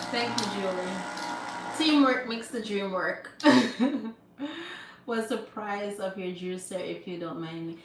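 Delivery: usual speaking voice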